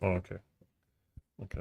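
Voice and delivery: normal talking voice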